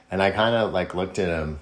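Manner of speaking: normal talking voice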